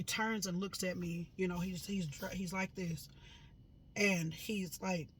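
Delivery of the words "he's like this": ordinary talk, nothing marked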